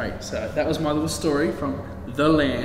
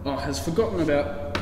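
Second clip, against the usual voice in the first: plain reading voice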